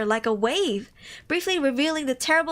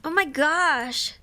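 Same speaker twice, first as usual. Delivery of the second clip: slow moved sigh; much slower than usual. Real, not acted. Moved by story.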